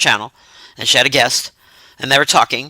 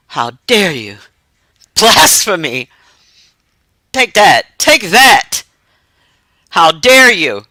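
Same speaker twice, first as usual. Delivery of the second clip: hammed-up indignant cry, louder; mock outrage; 2 semitones lower, 3 dB louder, slower than usual. Streamer to a critic in chat, mock outraged. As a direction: act scandalized at the chat.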